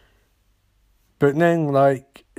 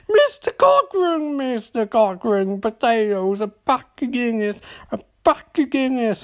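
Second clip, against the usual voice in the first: very Irish guy; 8 semitones higher than usual. Acted. Irish hotel man calling to passing lawyer, exaggerated Irish accent. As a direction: call out in a hammed-up, high Irish voice. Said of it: "there's this very Irish guy".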